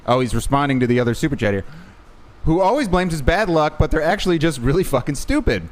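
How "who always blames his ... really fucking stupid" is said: higher, lively read-out; reading a viewer message. Acted. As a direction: read the chat message out to the audience. Reads out viewer's super chat.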